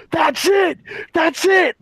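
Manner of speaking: high shouted outburst; crazy yell. Retold or imitated. Coach losing it at tackle drills, high shout. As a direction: explode at the players. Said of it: "I'm going crazy".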